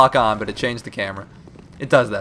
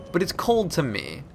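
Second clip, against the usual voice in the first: ordinary talk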